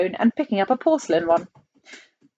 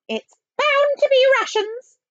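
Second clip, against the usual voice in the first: very high, loud nanny voice; piercingly high and insistent; nanny character; 5 dB louder than usual. Acted. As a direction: pipe up forcefully as the nanny. Miss Tibbs chimes in.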